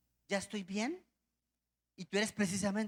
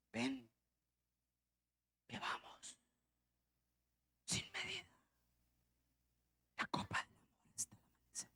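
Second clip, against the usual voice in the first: softer, half-whispered temptress voice; man doing a woman's voice; 4 semitones higher, 13 dB quieter than usual. Retold or imitated. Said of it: "poner voz de mujeres".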